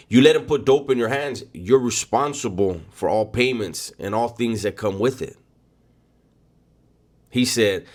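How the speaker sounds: usual speaking voice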